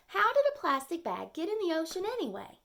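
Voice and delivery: high, fast, indignant question. Acted. Turtle demanding how the bag got there, high and quick. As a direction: rattle it off, baffled and high.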